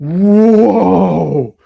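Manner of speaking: long, loud, high "whoa"; imitating someone blown away on coming to; awestruck whoop. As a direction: burst out amazed, stretching the vowel. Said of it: "like, this little kid".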